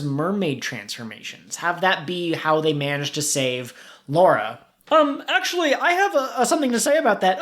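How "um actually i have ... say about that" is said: high, nerdy eager voice; a know-it-all jumping in, higher; Pete character voice. Acted. Plays character Pete interrupting.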